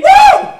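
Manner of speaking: very high, loud whoop; scream of joy. Acted. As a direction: shriek a joyful woo. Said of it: "It's a shout of joy".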